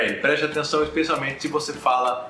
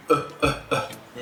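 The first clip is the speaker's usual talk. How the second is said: schwa sound; much slower than usual. Shown as a demonstration. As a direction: murmur a short schwa. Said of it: "um som aqui de um schwa".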